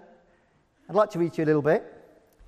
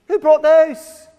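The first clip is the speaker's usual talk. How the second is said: much higher, louder and slow; eyes popping at the eggs; amazed question; 10 semitones higher, 11 dB louder, much slower than usual. Acted. Housemaid Lulan seeing the eggs arrive, amazed. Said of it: "her voice lacking some of its earlier scorn".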